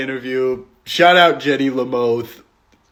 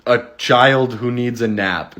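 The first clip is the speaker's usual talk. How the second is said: ordinary talk, nothing marked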